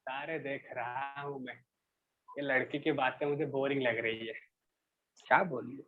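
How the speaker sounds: bored watchman. Acted. Teacher voices bored watchman.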